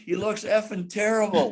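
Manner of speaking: high-pitched retold rant at the stage manager; producer yelling. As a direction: imitate an exasperated producer yelling orders. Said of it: "he's yelling at her".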